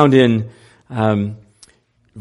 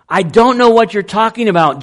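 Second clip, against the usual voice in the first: fast, higher protest; insistent denial; 8 semitones higher, 4 dB louder, faster than usual. Acted. Peter brushing off the man who insists he's Galilean. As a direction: brush them off in a hurry. Bystander accuses, Peter denies again.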